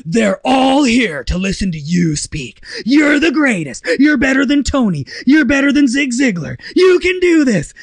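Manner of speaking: loud, high motivational hype; mock pep talk. Hyping himself in the mirror, loud mock-heroic pep talk. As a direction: pump yourself up like a motivational speaker.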